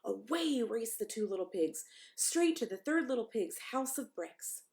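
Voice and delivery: ordinary delivery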